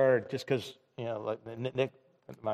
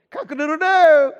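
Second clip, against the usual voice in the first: hammed-up rooster crow, high and loud; bad rooster crow; 12 dB louder than usual. Preacher mimics the crow of condemnation, silly rooster crow. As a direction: crow like a rooster, badly on purpose. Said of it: "That was a terrible rooster, man".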